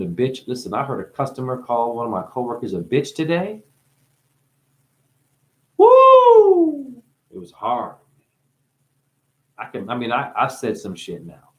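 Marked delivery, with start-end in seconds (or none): 5.8-7.0 loud high hooting woo. Real, not acted. Reacting to a customer's insult with a loud woo. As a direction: let out a big shocked whoop.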